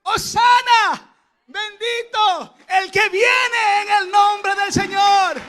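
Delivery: high, loud, drawn-out acclamation; shouting praise like an excited crowd; frenzied crowd shout. Acted. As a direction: cry it out slowly at full voice. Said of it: "los gritos frenéticos como el de este niño, gritando".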